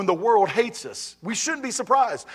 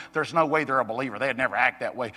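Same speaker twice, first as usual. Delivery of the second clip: lower, quick, judgmental mimic; judgmental churchgoer; 8 semitones lower, 4 dB quieter, faster than usual. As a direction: sneer it like a self-righteous member. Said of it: "get to the point of going".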